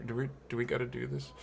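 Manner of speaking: quick, hushed group grumble; reluctant aside. Acted. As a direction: ask it fast and under the breath. Group reluctant, looking at each other.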